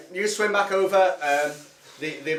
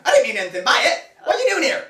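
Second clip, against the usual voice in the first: put-on high, cracked old man squeak; 6 semitones higher, 4 dB louder, faster than usual. GM voicing the old lake hermit, high old man voice. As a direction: play the naked old hermit, high and quavery. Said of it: "he goes".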